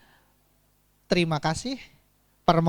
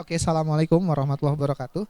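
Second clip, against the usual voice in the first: rushed practice greeting; 4 semitones lower, much faster than usual. Shown as a demonstration. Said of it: "saya berlatihnya pakai botol aqua".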